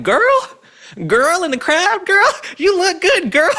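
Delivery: very high, loud, exaggerated catcall; mocking Barack Obama. Host mimicking Obama flirting with the crowd, hammed-up sass. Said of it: "I've never heard a straight man be like".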